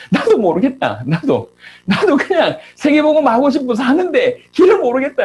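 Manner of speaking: retold frustrated comeback, pitch climbing high; retort. Leader answering his angry friend back, exasperated retort. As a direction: fire back in helpless exasperation. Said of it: "받아쳤던".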